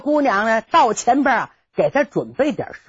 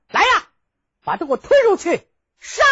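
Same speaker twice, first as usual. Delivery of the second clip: 下令斩首的喊声; 9 semitones higher, faster than usual. Acted. 山大王下令杀人.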